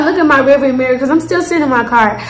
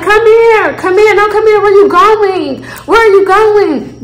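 higher, taunting him to come back; calling after him; 7 semitones higher than usual. Retold or imitated. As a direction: shout down the street after him. Calling after fleeing man.

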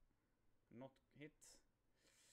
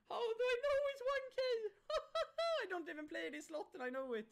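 shrieking giddy laugh; 5 dB louder than usual. Real, not acted. Giddy brag after a big win.

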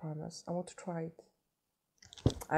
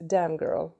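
ordinary talk

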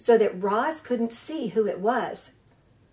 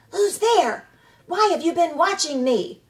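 hissed up; 7 semitones higher, 5 dB louder than usual. A narrator's reading. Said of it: "voice hissed up from the shadows".

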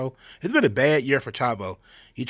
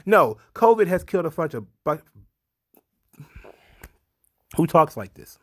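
louder, higher exasperated rant; 4 semitones higher, 5 dB louder than usual. Real, not acted. Vlogger ranting at a showrunners' statement, exasperated. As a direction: throw up your hands at the wording. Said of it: "mocking rant".

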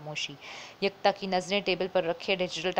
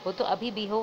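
ordinary delivery, nothing marked

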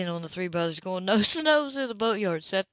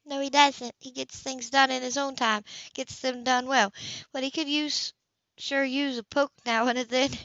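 Anna's voice; 6 semitones higher than usual. Acted. Anna agrees wryly, higher.

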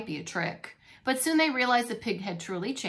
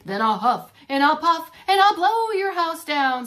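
building louder, higher threat; 6 semitones higher, 10 dB louder than usual. Acted. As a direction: bellow it bigger and bigger. Pig threatens to blow house.

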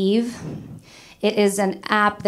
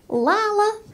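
high-pitched Alabama mom calling out; Alabama mother-in-law; 11 semitones higher than usual. Acted. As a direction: put on a high Southern mother voice. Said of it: "married a girl from Alabama".

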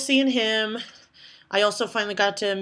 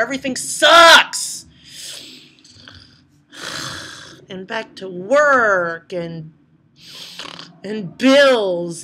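mock despair; 7 dB louder, slower than usual. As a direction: drag it out like the world is ending. Whining about going home.

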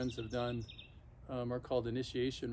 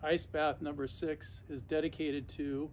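ordinary talk, nothing marked

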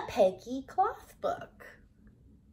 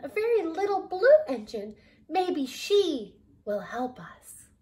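hopeful clown; 5 semitones higher, 6 dB louder than usual. A narrator's reading. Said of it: "called out the little clown".

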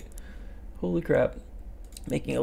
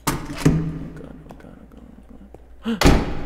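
panicked muttering of oh god; frantic scared babbling; terrified gibbering; 4 semitones higher, 6 dB louder, faster than usual. Real, not acted. Scared by ghost face nearby.

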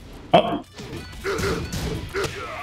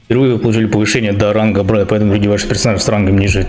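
rattling off the game text fast; fast reading aloud; much faster than usual. Acted. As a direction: read the notice quickly. Reads game rank message aloud. (быстрое чтение вслух)

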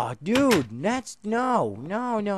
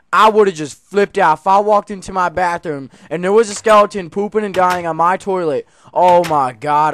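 excited rant; 3 semitones higher, 11 dB louder than usual. Real, not acted. Imagining skeleton in bathroom.

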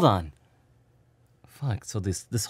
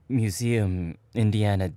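ordinary talk

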